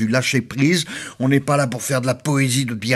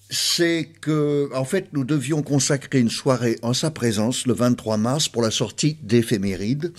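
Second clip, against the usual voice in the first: ordinary delivery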